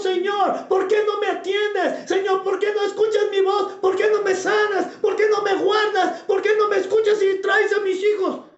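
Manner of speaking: high-pitched, insistent wail of complaint; complaining plea. Acted. Preacher acts out believers complaining to the Lord, insistent plea. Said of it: "nos quejamos y le decimos".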